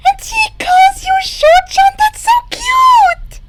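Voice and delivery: put-on high, loud girlish squeal. Hagakure over the screenshot, high loud delighted squeal. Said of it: "Hagakure squealed".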